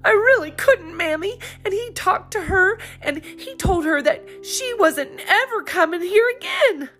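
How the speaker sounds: put-on distressed girl. A narrator's reading. Polly continues distressed.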